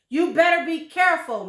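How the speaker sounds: high, loud, fast warning; sharp threatening old wife; shrill warning. Acted. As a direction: snap a warning at your husband. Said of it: "Missus Twit said".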